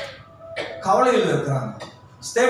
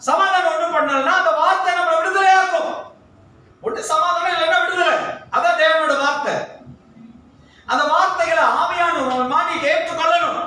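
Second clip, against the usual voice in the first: fiery preaching; 8 semitones higher than usual. Acted. Pastor urging the congregation to trust God, fiery preaching. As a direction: preach it hard and fast to the crowd.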